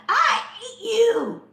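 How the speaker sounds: high, slow, laughing mock child yell; child's scream. Mother hears her son's silly threat, high and slow. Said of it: "screamed at her".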